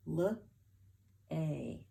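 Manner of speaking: L-A as two separate sounds, luh, ay; isolated phonics sounds for L-A; sounding out. Shown as a demonstration. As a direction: break L-A into luh, then a long ay. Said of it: "Last sound I hear".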